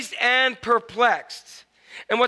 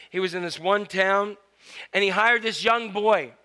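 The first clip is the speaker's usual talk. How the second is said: normal talking voice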